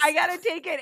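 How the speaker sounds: high, tight, strained voice; strained tension. Retold or imitated. Reliving the painful massage for the podcast, high strained voice. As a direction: squeeze it out through the pain. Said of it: "That's the tension in the voice".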